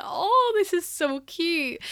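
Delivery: high, stretched-out adoring coo; cooing onlookers. Retold or imitated. Customers watching the gift being given, cooing. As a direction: imitate a gushing crowd, loud at first.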